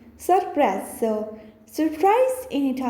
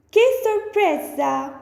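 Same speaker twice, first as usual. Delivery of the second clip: "Che sorpresa" higher, with surprise; bright astonished delivery of "Che sorpresa"; surprised; 7 semitones higher than usual. Shown as a demonstration. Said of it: "So next one is".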